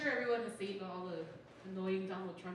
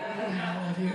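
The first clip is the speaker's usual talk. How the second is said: mock lovestruck gushing; 4 semitones lower, 9 dB louder than usual. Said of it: "After, like".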